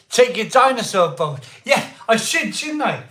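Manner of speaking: high, loud, laughing; giddy excitement. Real, not acted. Vlogger squealing over showing dinosaur finds. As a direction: squeal with glee.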